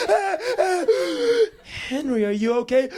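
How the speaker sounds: high, fluttery worried voice; old tour lady fussing over a kid; concerned old lady. Retold or imitated. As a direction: fuss over the boy like a granny. Old lady finds upset boy.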